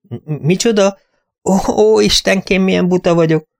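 high, flustered self-reproach; flustered companion. Acted. Companion caught in a silly mistake, high and flustered. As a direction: flap and scold yourself, pitched high.

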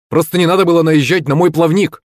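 high, heated shout at an opponent; shouting angrily. A narrator's reading. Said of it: "кричал в сторону слуги".

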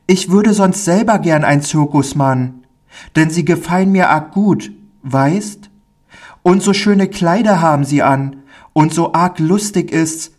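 put-on high child's voice, artless and confiding; timid, eager little boy. Pauli tells the friendly man his circus wish, high boyish voice. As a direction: play a small boy confiding a wish and a worry.

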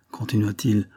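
ordinary talk, nothing marked

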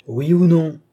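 loud, high, angry demand; furious shout. A narrator's reading. Old man rising from his chair to demand an answer, shouting. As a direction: rise up and shout it.

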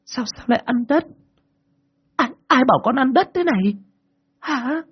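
high, stammering mother demanding answers; mother questioning. A narrator's reading. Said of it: "hỏi đi hỏi lại".

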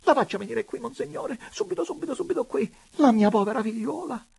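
high-pitched, breakneck gush of joy; giddy, high excited urging; frenzied jubilation. A narrator's reading. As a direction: tumble the words out in delight. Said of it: "proruppe allora con furia di giubilo".

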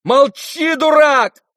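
very high, loud order to hush; angry shout. Acted. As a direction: shout to silence a brother. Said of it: "закричал Коля".

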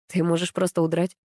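usual speaking voice

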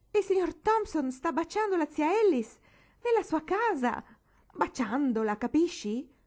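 high, loud breathless outburst; furious, panting. A narrator's reading. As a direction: blurt it out furious. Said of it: "ansando, furiosa". (la bambina furiosa e ansante con la mamma)

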